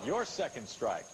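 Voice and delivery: ordinary talk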